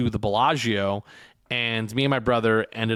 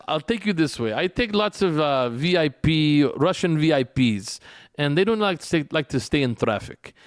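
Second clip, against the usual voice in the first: Russian driver impression; 3 semitones higher than usual. Retelling the driver's boast in a light Russian accent. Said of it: "I swear this is how he sounded".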